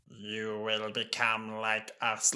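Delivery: slow sing-song robotic drone; Tenth Planet Cyberman. Retold or imitated. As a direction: stretch every word out mechanically. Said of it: "'Cause they're like".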